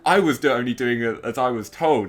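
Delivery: loud, high, mock-defensive outburst; heightened blockbuster protest. Podcaster parodying a character defending himself, hammed-up indignation. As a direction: overact an indignant self-defence. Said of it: "like, heightened blockbuster".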